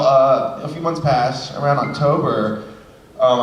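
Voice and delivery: ordinary delivery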